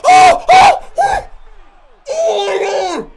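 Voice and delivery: very high, very loud screams; screaming in shock. Real, not acted. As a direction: scream as the wrestler crashes. Screaming at a big crash.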